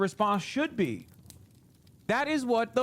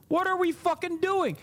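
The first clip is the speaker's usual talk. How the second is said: higher outraged cry; exasperated outburst; 10 semitones higher than usual. Real, not acted. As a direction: let the outrage peak. Rising angry rant.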